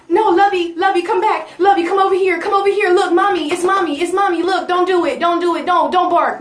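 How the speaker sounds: high, loud coaxing of a dog; urgent pleading. Acted. Pleading with police dog like pet.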